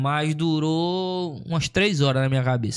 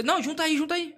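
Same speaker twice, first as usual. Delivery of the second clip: much higher, eager call to join the tables; calling over; 11 semitones higher, 3 dB quieter than usual. Retold or imitated. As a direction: sing out an invitation across the bar. Neighbouring table calls them over.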